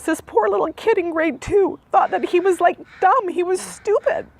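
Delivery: barely holding it together; crying. Real, not acted. Mother reliving her son's relief, openly crying. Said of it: "it just gets me every time".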